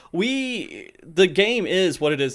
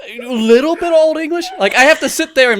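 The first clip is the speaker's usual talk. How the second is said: laughing rant; 7 semitones higher, 5 dB louder than usual. Real, not acted. Laughing rant about Urianger's speech.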